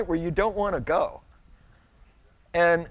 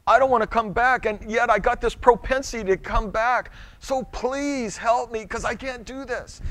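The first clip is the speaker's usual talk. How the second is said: earnest, pleading, raised pitch; pleading prayer; 7 semitones higher, 3 dB louder than usual. Retold or imitated. Preacher voicing a repentant heart, pleading prayer. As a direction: beg God for help, sincerely. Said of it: "an attitude inside going".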